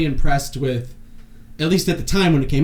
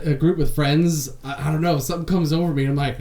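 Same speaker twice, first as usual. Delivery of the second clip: ordinary delivery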